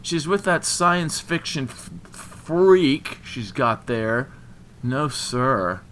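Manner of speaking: loud, high outburst, words stretched; angry scorn. A narrator's reading. Jilted man sneering at her new boyfriend, angry scorn. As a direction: cut in loudly and drag out the insult.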